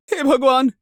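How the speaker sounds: high cry of alarm; frightened exclamation. Acted. Woman realising someone is in her room, high and terrified. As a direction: cry out to God in sudden fright.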